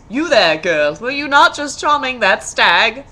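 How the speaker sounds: loud, commanding prince voice; prince calling out. A narrator's reading. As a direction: demand it like a haughty prince. Prince questions the girl.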